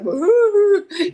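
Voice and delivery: high, loud wavering mock sob; imitated upset wail. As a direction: mimic a child's wobbly crying sound. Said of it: "I could just see the".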